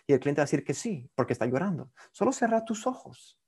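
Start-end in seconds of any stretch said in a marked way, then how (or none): 2.1-3.4 soft, lifted hypnotist voice; calm hypnotist command; soft hypnotic instruction. Shown as a demonstration. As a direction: gently tell them to close their eyes. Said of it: "siempre es recomendable preguntar".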